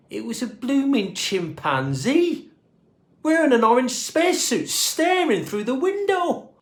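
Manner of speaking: rising high and loud in amazement; astonished reveal. A narrator's reading. Narrator revealing the chimp, rising high and loud. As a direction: build to a squeal of disbelief.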